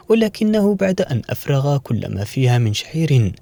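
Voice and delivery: ordinary delivery, nothing marked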